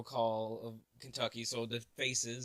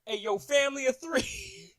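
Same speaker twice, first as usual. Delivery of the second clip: loud, high hollering at passers-by; big mock yell for attention; imitated yelling; 13 semitones higher, 8 dB louder than usual. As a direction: call out to strangers like a rowdy vendor. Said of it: "yelling at people, pointing people out".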